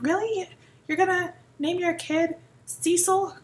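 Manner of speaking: high, disbelieving imitation of a stranger; exaggerated judgy disbelief; mock incredulity. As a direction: question it high, as if appalled. Mimics incredulous strangers on name.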